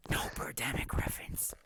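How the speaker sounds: whispered aside; a quick whispered quip. Real, not acted. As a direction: hush it like a secret joke.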